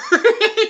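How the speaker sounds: loud, high burst of laughter; real laugh breaking out. Real, not acted. After teasing his friend, a loud real laugh.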